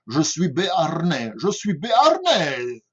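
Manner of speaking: put-on Béarnais accent with heavily rolled Rs; proud old Béarnais, drawling out the trilled Rs. Teacher mimicking southern French locals, rolled-R Béarnais accent. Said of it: "peuvent rouler les R".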